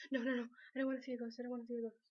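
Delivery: fast, mock-panicky refusal; hurried retraction. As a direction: rush to take the wish back. Acts out taking back the wish.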